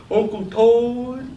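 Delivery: put-on thick Southern little boy. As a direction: play a drawling five-year-old, laughing through it. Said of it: "when he was little, everything was thick".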